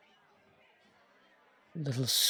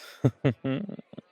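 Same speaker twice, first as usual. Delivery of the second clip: higher chuckle lilting upward; pleased chuckle; 5 semitones higher, 5 dB louder than usual. Acted. Vampire pleased with his guest's spirit, sly rising chuckle.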